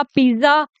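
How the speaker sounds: पिज्जा said "pi-za", buzzed z; wrong "pizza" with z. Shown as a demonstration. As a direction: voice a plain z in पिज्जा, no t. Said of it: "नहीं बोलते".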